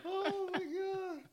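high, soft, slow laughed-out words; laughed-out gasp. Real, not acted. Recovering from the dropped-bowl story, laughed-out squeak.

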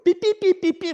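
high-pitched imitated electronic beeps; quick squeaky mimicked keypad tones. Imitating the neighbor punching in the code, beeping.

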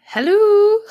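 high, sing-out hello; mock calling out. Player echoes the call into the empty house, mock calling out.

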